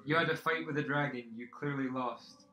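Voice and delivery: loud, challenging commander; forceful game-master villain; sceptical captor. Acted.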